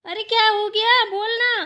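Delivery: high, impatient holler; throat-tearing shout. Acted. Mother-in-law demanding what happened, throat-tearing shout. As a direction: shout at full voice to be heard far off. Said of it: "गला फाड़ फाड़ चिल्ला रही हूँ".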